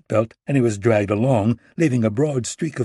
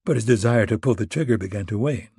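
ordinary delivery

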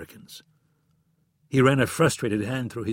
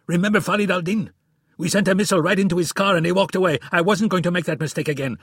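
yelling; 8 semitones higher, 6 dB louder, faster than usual. A narrator's reading. Friedman screaming in quarrel.

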